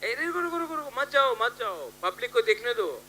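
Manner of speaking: high, hurried call to wait; calling to stop. Real, not acted. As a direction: halt them before they leave, voice starting high. Stops people walking off stage. (रोकने के लिए पुकारते हुए)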